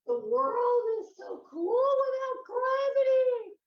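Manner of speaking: loud, high-pitched exclaiming; over-the-top awed yelling; giddy amazement. Acted. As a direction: shout it out in giddy wonder. Acting out delight at weightlessness.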